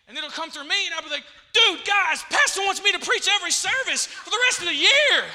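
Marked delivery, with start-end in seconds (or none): 1.5-5.3 high, very loud, thrilled outburst; excited. Acted. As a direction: shout the great news to your friends. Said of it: "And I'm excited".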